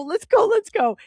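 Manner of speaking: high, loud, eager let's go; excited mock urgency; urgent retold hurry. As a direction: push to get going, urging. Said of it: "I go".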